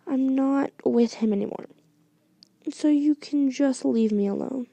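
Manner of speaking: ordinary delivery, nothing marked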